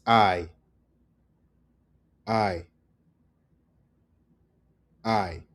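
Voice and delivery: hay said like English "I"; silent H. Shown as a demonstration. Teacher correcting learners, hay pronounced "I", silent H. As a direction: say "I" cleanly with no H. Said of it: "it's not hi".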